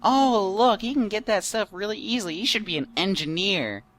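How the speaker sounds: high, loud mock parent; hammed-up clueless parent. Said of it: "jump to conclusion parents".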